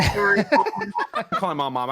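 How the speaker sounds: loud, high, drawn-out mock wail; mock tantrum. Accused player threatening to call mom, loud mock tantrum. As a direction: playfully wail it, half laughing.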